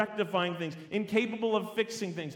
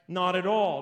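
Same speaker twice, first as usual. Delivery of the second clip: firm, louder emphatic rejection; emphatic denial; 6 dB louder than usual. Acted. Preacher voices Paul's answer to the objection, emphatic. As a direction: land the denial with force. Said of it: "Paul answers with an emphatic".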